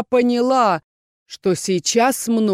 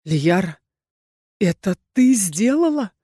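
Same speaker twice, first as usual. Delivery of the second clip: strained and pained. A narrator's reading. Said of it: "спросил натянуто и болезненно".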